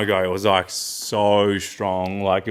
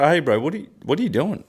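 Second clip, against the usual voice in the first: puzzled roommate; 7 semitones higher than usual. Retold or imitated. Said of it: "I was like".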